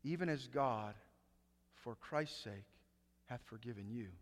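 hushed awe on the verse; hushed repeat. Shown as a demonstration. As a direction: drop almost to a murmur to let it sink in. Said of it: "Wait a second".

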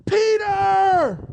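high, loud, drawn-out joyful shout; excited outburst. Acted. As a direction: burst out shouting the name. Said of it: "they burst into excitement".

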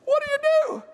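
very high, choked-up mock blubbering; mock crying. Father dumbfounded by his daughter, mock crying. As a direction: break into a helpless high whimper. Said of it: "I'm crying now".

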